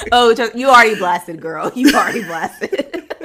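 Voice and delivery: loud, high teasing comeback; laughing tease. Real, not acted. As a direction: shout it teasingly through real laughter.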